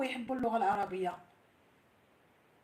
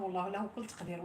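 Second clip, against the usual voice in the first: normal talking voice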